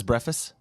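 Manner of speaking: breakfast said as "breffist"; variant pronunciation. Retold or imitated. Co-host quoting an acquaintance's pronunciation, breakfast as "breffist". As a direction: skip the K in breakfast, "BREFF-ist". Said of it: "Andy Pollock says breakfast".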